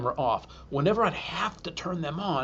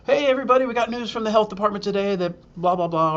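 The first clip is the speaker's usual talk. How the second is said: upbeat broadcast greeting; 6 semitones higher, 3 dB louder, faster than usual. Retold or imitated.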